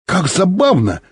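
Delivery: high-pitched cry of wonder; delighted exclamation. A narrator's reading. Pilot sees the strange stones, exclaiming in wonder. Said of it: "вскричал Михаил Антонович".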